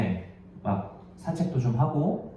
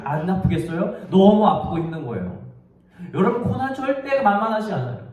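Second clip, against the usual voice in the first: raised, emphatic sermon delivery; impassioned preaching; 8 semitones higher, 4 dB louder than usual. Real, not acted. Preacher telling the congregation suffering truly hurts, impassioned. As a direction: preach it with mounting insistence. Preacher drives home the point.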